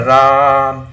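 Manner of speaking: राम with a very long, loud held aa; stretched RAM. Shown as a demonstration. Teacher showing how the vowel is stretched, drawn out. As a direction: hold the vowel of राम steady for seconds. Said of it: "लेकिन वो खींच रहा".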